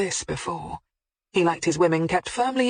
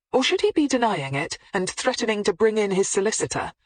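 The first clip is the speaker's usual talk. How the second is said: ordinary delivery, nothing marked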